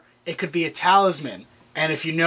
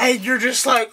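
usual speaking voice

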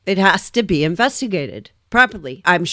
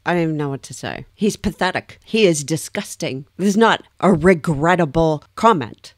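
ordinary delivery

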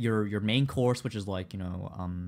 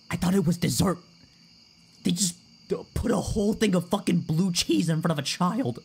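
loud, high-pitched outraged lament; mock distraught; 6 semitones higher, 4 dB louder than usual. Streamer telling chat of a childhood dessert betrayal, distraught. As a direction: wail it like a betrayed child, hammed-up. Distraught retelling of blue cheese trauma.

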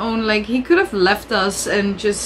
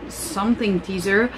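usual speaking voice

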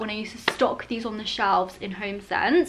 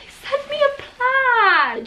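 much higher, slow and drawn out; real excited gush; delighted; 12 semitones higher, much slower than usual. Real, not acted. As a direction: gush over the gift. Delighted by the plant gift.

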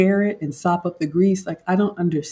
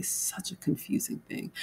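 a soft, breathless whispered aside; whispered to herself, puzzled; 14 dB quieter than usual. Real, not acted. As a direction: say it under your breath, unvoiced.

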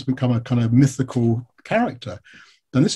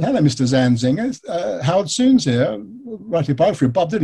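higher, polite cold-call voice; recounted phone greeting; 6 semitones higher than usual. Retold or imitated. Biographer cold-calling a man from the phone book, polite. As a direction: re-enact your polite phone introduction. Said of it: "and I said".

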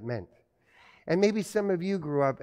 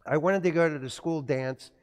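ordinary talk, nothing marked

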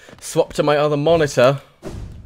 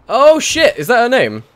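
surprised; 9 semitones higher than usual. Real, not acted. Sudden realisation in game.

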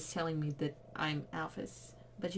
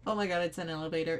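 ordinary delivery

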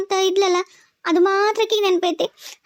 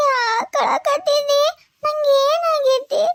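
slow, higher, crying voice asking why everyone weeps; tearful confusion; 5 semitones higher, much slower than usual. Acted. Young wife asking the crying family why, slow and tearful. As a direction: ask through tears, drawn out and bewildered.

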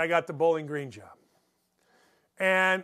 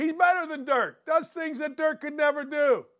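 higher, sarcastic parroting of the coach; mocking quote; 6 semitones higher than usual. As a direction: mimic the coach's words with a mocking lift. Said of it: "So he says those things".

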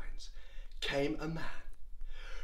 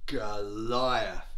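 ordinary delivery, nothing marked

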